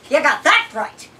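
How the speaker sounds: mock it with a sneer. A narrator's reading.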